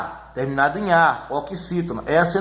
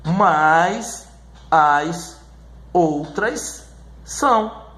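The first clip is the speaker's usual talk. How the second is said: slow, each word set apart by pauses; word-by-word recitation; 3 semitones lower, much slower than usual. Shown as a demonstration. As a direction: spell out the rule one word at a time. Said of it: "Esse é o que você tem que dizer".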